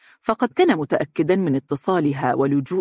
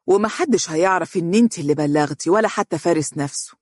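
fast, pushing reassurance; quick, insistent persuasion; hurried; faster than usual. Acted. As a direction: rush her before she thinks. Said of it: "وقال بسرعة".

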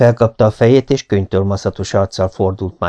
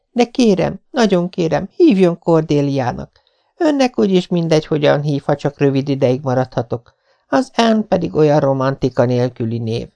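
put-on higher, begging girl's voice; pleading young girl; 6 semitones higher than usual. A narrator's reading. Anne begs Marilla to call her Cordelia. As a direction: coax the grown-up sweetly.

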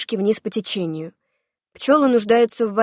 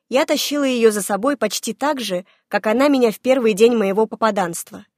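ordinary delivery, nothing marked

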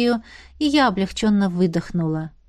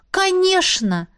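high, eager shout of welcome; eager exclamation; 10 semitones higher, slower than usual. Acted. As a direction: call out gladly together. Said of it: "хором воскликнули". (радостное восклицание)

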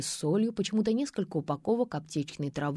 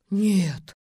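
louder, dismayed moan; groaning; 6 dB louder than usual. A narrator's reading. Mitrofanova dreads minding the neighbour's hens, groaning. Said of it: "простонала Митрофанова".

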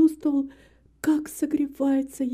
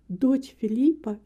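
usual speaking voice

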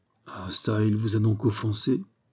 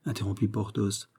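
ordinary delivery

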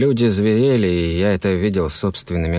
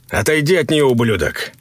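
raised, forceful threat; angry warning; 6 semitones higher than usual. A narrator's reading. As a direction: warn him off his wife. Threatening the man nearing his wife.

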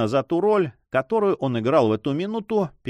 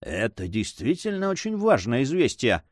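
plain reading voice

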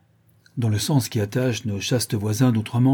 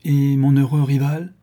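higher, louder proud question; proud demand; 5 semitones higher, 6 dB louder than usual. A narrator's reading. Young suitor asking after his rival, proud and challenging. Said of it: "demanda fièrement Ivan".

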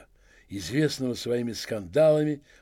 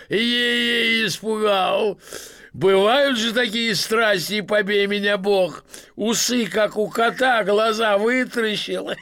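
loud, higher, jeering between laughs; mocking through laughter; 8 semitones higher, 5 dB louder, slower than usual. Masked man mocking the policeman, laughing and loud. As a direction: tease the scary policeman.

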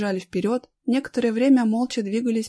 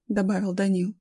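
usual speaking voice

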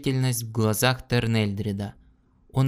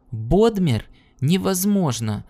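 higher, louder, shocked outcry; shocked cry; 6 semitones higher, 3 dB louder than usual. Acted. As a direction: cry out in disbelief. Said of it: "вскричал Джаларн".

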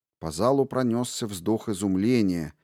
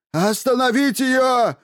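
high shrill panicked shriek; half howl, half squeal; shrieking yell; 14 semitones higher, 5 dB louder than usual. Acted. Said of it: "Не своим голосом не то взвыл, не то взвизгнул".

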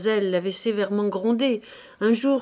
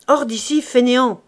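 indignant shout; 2 semitones higher, 7 dB louder than usual. A narrator's reading. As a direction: bark Hors d'ici, fainéant at the beggar. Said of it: "finit par crier Chantot indigné".

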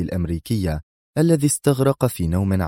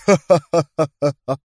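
high, mocking stage laugh; loud abrupt cackle; sudden loud laugh; 8 semitones higher, 5 dB louder than usual. A narrator's reading. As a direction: burst out laughing defiantly. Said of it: "قاطعه القائد بضحكة عالية مباغتة".

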